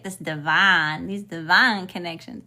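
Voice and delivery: thick southern accent. Real, not acted. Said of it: "you hear my southern accent coming out real thick".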